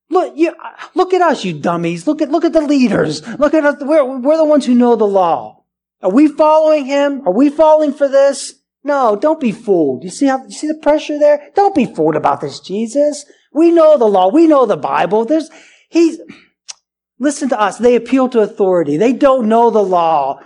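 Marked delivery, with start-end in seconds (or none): 0.1-10.0 high, pushy, talking down; condescending leaders. Retold or imitated. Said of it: "these leaders are very condescending". 11.6-17.8 high, pushy, talking down; condescending leaders. Retold or imitated. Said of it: "these leaders are very condescending".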